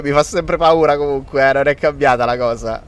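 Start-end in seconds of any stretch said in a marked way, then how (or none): none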